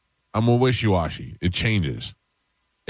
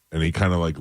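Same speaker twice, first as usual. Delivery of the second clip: ordinary delivery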